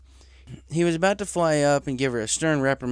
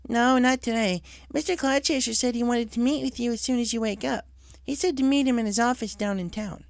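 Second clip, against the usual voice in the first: higher, friendly mail-mare voice; Derpy; 11 semitones higher than usual. Acted. Derpy at the door passes on Cloud Chaser's message. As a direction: cheerfully deliver the message.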